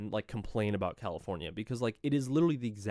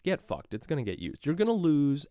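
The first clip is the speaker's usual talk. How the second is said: normal talking voice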